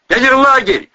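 loud, high exclamation; excited high shout; exclaiming. A narrator's reading. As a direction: call out the sudden discovery. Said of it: "воскликнул Планшетов".

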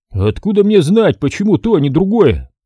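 обиженный дядька, голос выше; обиженно сопя, оправдывается. Acted. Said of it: "обиженно засопел Ермоленко". (хозяин обиженно отвечает рассказчику, голос выше)

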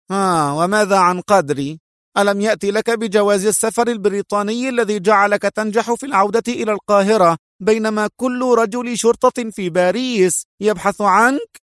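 high, indignant challenge; angry. A narrator's reading. Woman challenging her partner about his helper, high and angry. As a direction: throw the accusation at him, pitched high. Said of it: "قالت في غضب".

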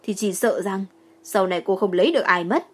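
ordinary delivery, nothing marked

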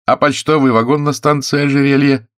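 high, loud, insistent question; pressing interrogation. Acted. Investigator confronts thief about the mail car, insistent. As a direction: fire the next charge, pitch up.

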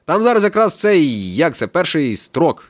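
slow, searching for the right word; groping for words. A narrator's reading. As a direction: drag it out while thinking. Kakao fumbling for the word.

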